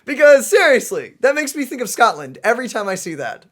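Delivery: usual speaking voice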